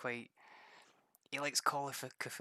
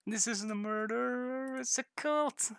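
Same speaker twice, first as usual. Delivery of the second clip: much higher and slow, a stunned realisation; dramatic revelation; 12 semitones higher, 3 dB louder, slower than usual. Acted. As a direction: gasp out the twist like a horror movie discovery. Acting out the big reveal in game.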